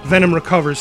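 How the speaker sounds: ordinary delivery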